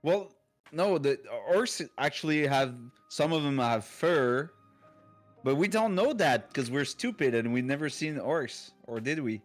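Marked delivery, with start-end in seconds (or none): none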